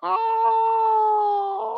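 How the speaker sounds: high held gasp of surprise; a long high oh of shock; surprised oh. Real, not acted. Hearing the friend is pregnant, high surprise.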